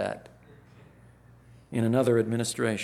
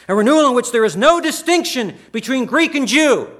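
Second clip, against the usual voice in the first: full-force preaching; 13 semitones higher, 13 dB louder than usual. Acted. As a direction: preach it out loud and high, in full fervour. Preaching burst on scripture.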